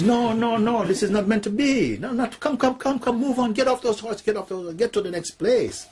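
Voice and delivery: very high-pitched, hurried commands; frantic ordering about. Acted. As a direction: herd them along in a high, insistent voice. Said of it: "Like this they're going".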